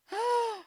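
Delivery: sharp dramatic gasp; high gasp. Acted. Storyteller gasping in mock suspense for children.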